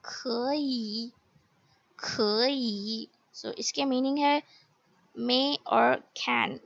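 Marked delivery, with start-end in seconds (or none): 0.1-1.1 खई drawn out, rising then dipping; slow model खई in two tones; tone change demonstration. Shown as a demonstration. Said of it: "tone में convert हो गई". 2.0-3.1 खई drawn out, rising then dipping; slow model खई in two tones; tone change demonstration. Shown as a demonstration. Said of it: "tone में convert हो गई".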